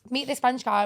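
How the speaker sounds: ordinary delivery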